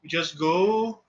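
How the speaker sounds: usual speaking voice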